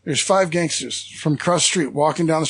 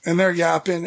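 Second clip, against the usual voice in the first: ordinary delivery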